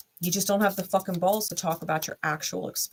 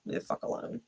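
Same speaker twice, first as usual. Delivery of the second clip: muttered under breath; 10 dB quieter than usual. Retold or imitated. Mocking the partner brushing her off.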